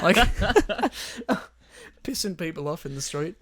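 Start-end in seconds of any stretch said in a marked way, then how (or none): 0.2-1.5 high laugh. Real, not acted. Laughing at own story.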